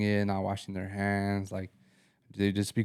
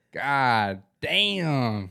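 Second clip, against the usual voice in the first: dramatic disgust; 4 semitones higher, 4 dB louder than usual. Retold or imitated. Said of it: "be so dramatic".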